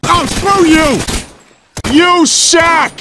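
loud playful outrage; mock outrage shout. Real, not acted. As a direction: yell it back in fun. Playful insult shouted back.

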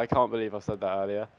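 normal talking voice

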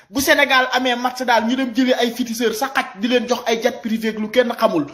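ordinary delivery

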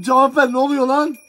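ordinary delivery

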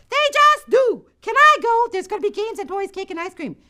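put-on high, loud eager girl; begging her mother to let her go; high pleading child's voice.

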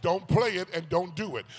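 usual speaking voice

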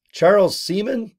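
put-on a light child's puzzled voice; kids puzzled. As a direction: ask it like a confused kid. Kids repeating the name.

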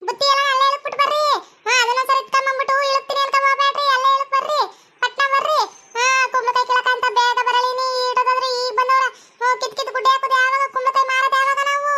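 high-pitched, irritated farm boss voice; cross farm boss. Acted. Farm boss hurrying late pumpkin pickers to start, cross. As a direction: order the workers about in a high, cross voice. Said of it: "ಯಾಕೋ ಕೋಪದಲ್ಲಿದ್ದಿ".

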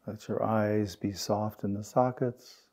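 unhurried meditation guide, long pauses between phrases; guided meditation. Real, not acted. Teacher guiding the group through belly breaths and settling, guided meditation.

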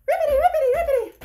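very high, loud ribbits; frog croak. Acted. Leader showing kids the hopping choice, high frog croaks. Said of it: "do you want to hop".

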